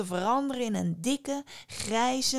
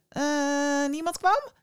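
put-on higher, eager young fisherman asking; a curious fisherman, pitched up; 6 semitones higher, 3 dB louder, slower than usual. A narrator's reading. Fisherman guesses the answer. (hogere, nieuwsgierige vissersstem)

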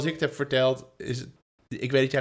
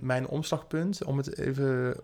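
ordinary delivery

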